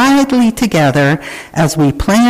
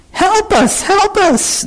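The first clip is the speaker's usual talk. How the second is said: very high, small storybook voices calling for help; acted high-pitched plea; crying for help; slower than usual. A narrator's reading. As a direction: squeal it high like stranded kids, without much volume. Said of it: "they heard familiar voices cry".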